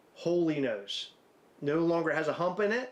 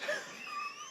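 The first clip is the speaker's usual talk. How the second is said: very high, sharp burst of laughter; a squealing laugh that cracks up; shrieking laugh; 10 dB quieter than usual. Real, not acted. Man cracking up at his own cheekbone face, shrieking laugh.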